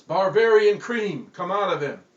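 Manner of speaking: booming exorcist shout; hollered. Acted. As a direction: cast out the donut. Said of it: "this man hollered out the names".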